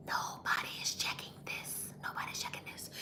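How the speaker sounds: confidential whisper, barely audible. Real, not acted. Host slipping viewers a secret on a livestream, whispered. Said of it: "my whispering".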